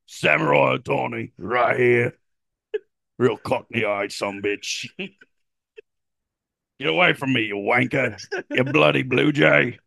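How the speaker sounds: high, slow caricature Cockney; hammed-up Cockney bluster; Shohei Ohtani as Cockney. Star fending off a rival team, mock Cockney. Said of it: "like, Cockney British".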